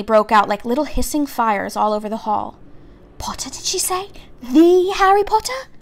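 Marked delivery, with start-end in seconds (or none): 3.2-5.7 whispering crowd. A narrator's reading.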